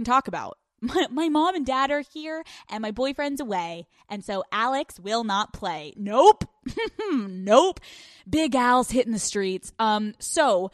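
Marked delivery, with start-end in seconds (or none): 6.0-7.8 firm, teasing refusal with a laugh; loud playful nope. Real, not acted. Refusing, Alex won't play.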